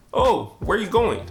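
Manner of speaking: normal talking voice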